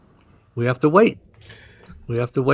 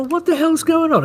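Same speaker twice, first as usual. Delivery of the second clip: high, startled what-the-hell; sudden panic as the price drops; shocked investor; alarmed confusion; 13 semitones higher than usual. Retold or imitated. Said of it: "And you go".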